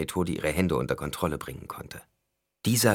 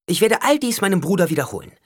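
defiant threat; 13 semitones higher, 7 dB louder, faster than usual. A narrator's reading. Alia droht trotzig.

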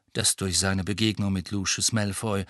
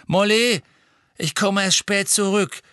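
raised, higher voice over the din; calling out; 9 semitones higher, 5 dB louder than usual. Acted. Man calling to Molly over the singing, raised voice. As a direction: call across the noisy room. Calling across noisy kitchen.

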